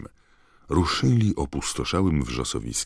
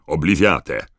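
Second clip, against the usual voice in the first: higher, loud, sharp incantation; 5 semitones higher, 9 dB louder than usual. A narrator's reading. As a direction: fire the spell out sharply and loudly. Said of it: "powiedział ostrym tonem". (ostrym, podniesionym tonem)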